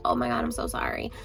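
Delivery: mock a hushed hollow sorry; whispered fake apology. Retelling the mom apologizing after hurting baby, whispered. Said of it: "she's just like".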